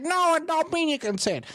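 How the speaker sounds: very high mock protest; hammed-up high-pitched objection; objecting critic. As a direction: squeal it like an outraged critic. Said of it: "you're gonna be like".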